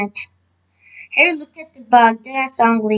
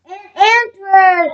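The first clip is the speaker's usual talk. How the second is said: drawn-out, higher-pitched cheer; excited shout; 7 semitones higher, 3 dB louder, slower than usual. A narrator's reading. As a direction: read it as a slow, moderate group shout. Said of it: "everyone shouted".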